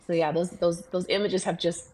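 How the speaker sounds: ordinary talk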